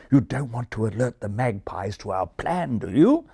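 ordinary delivery

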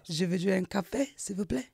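soft, shy attempt at French in own English accent; French said reluctantly in an English accent; modest, English-accented French; bad French accent. Shown as a demonstration. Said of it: "because my accent is bad".

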